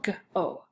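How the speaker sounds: "g-o" as a hard g, then a long O; sounded out. Shown as a demonstration. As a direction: tap out "g-o" in separate sounds. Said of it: "second syllable".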